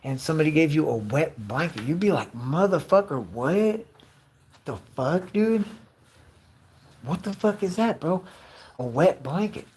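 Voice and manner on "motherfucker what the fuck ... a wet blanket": baffled, indignant protest rising in pitch; mock outrage. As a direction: act offended by a useless gift. Imagined reaction to wet blanket.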